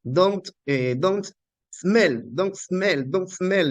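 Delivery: retold clumsy beginner's scolding in English; struggling learner's English. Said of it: "como yo no sa-, estaba aprendiendo".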